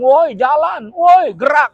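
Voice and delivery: loud mock yell at stuck cars; yelling in traffic. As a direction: shout it high and loud at the jam. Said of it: "Kita berteriak kenceng". (teriakan keras pura-pura di kemacetan)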